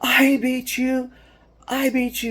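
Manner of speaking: put-on overjoyed teen celebrating first win; elated shout. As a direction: crow it like a kid who finally won. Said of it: "the elation on his face".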